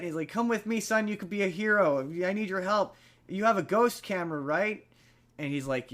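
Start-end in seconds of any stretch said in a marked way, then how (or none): 0.3-4.8 higher, eager priest's pitch; imitating a pushy priest. Priest urging a skeptic to become a hero, higher and eager. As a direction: sell it like a hammed-up recruiter.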